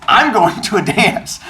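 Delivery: high, slow, mock-snooty; sarcastic retort. Teller mocking Fish's problem, high and sarcastic. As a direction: drag it out high and smug.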